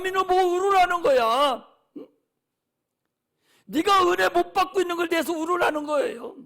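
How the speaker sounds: loud preacher's exhortation; full-force sermon cry to weep for your children; fiery preaching. Acted. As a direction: drive it home to the congregation.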